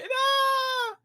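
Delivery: high dismissive nah; mock scoff. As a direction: brush him off. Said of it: "He said".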